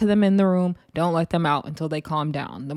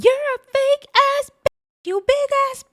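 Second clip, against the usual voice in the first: mock screaming; 5 dB louder than usual. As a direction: imitate her screaming insults at full pitch. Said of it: "they're yelling out the top of their lungs".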